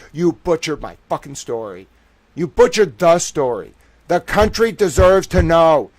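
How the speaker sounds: loud, rising yell at the paper. Real, not acted. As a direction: shout them down for the story. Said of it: "I have to yell at these motherfuckers".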